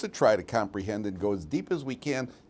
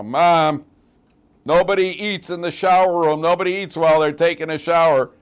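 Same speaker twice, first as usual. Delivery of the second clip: higher and louder, mock indignant; whiny kid protest; 6 semitones higher, 9 dB louder than usual. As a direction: retell a son arguing with mom. Kid protesting to his mother.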